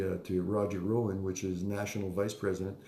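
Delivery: normal talking voice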